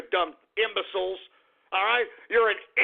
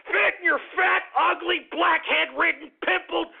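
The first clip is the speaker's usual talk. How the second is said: furious rant; 8 semitones higher than usual. Acted. Radio host screaming insults at the listeners in the mirror, high furious rant. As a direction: hurl each insult up high at them.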